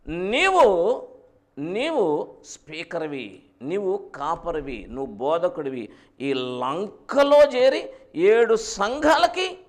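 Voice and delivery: slow, drawn-out divine proclamation; trumpet-like voice of God. Retold or imitated. God commissions John on the island, slow trumpet-like proclamation. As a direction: draw each title out like a trumpet call. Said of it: "బూరధ్వని వలె".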